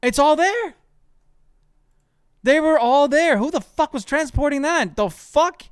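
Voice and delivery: high and loud, thrilled disbelief; can't believe what just dropped; real excited shouting; amazed exclaiming. Real, not acted. Amazed at the loot.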